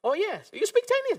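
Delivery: very high, excited squeak; mock delighted surprise. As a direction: imitate a stranger thrilled to find a shared language. Memerankan orang China yang girang. (menirukan orang girang, nada sangat tinggi)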